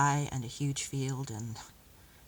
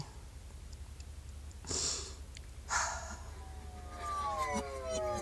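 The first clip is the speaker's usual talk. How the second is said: long, unvoiced sighing breath; a slow, soft, drawn-out sigh; 10 dB quieter than usual. Captive trying not to breathe the alien scent deeply, long quiet breath.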